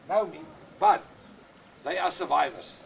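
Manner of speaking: ordinary talk, nothing marked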